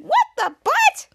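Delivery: very high, loud, drawn-out exclamation; mock outrage. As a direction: act out indignant disbelief. Acted outrage at interrupted commercial.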